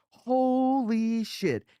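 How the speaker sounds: very high, very slow awed exclamation; impressed lieutenant. Retold or imitated. Lieutenant spotting the find in the grass, high and drawn out. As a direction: stretch it out like a dim officer amazed.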